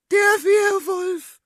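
high, loud, slow screech; drawn-out high panicked scream; terrified shriek. Acted. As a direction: shriek it out in sheer terror. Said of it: "kreischte Serafina".

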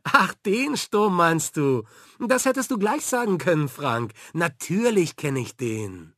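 teacher suddenly getting the joke; amused realisation. A narrator's reading. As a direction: slap your forehead and get it. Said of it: "Sonja schlug sich lachend gegen die Stirn".

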